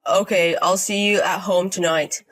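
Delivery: easygoing man calling in; husband on the phone. Acted. Dylan phones his wife from the forest, easygoing.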